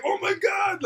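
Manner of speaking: high, loud amazed exclaim; amazed cry. Retold or imitated. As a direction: gasp it out in shock. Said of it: "they're like".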